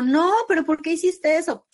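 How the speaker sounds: high, loud disbelieving protest; incredulous protest. Retold or imitated. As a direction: demand why he did it, shocked. Reclamo incrédulo tras el retorno. (reclamo incrédulo, agudo y fuerte)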